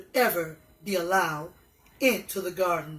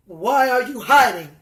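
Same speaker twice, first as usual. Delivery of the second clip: louder, firm question; questioning God; 8 dB louder than usual. Acted. God asking the hiding couple, firm and louder. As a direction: ask it firmly, a parent who already knows. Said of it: "asked God".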